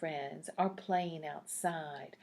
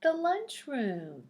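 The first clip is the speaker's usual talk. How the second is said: higher and louder, a bright big answer; excited reveal; 7 semitones higher, 7 dB louder than usual. Acted. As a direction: announce it like a happy surprise. Reveals answer to young listeners.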